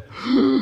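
big sharp gasp of cigar drag; deep inhale. Shown as a demonstration. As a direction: mimic sucking in smoke real deep. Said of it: "inhale real deep".

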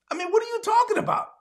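high, fast disbelief; what are you talking about outburst; incredulous. Real, not acted. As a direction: scoff at the excuse, fast and high.

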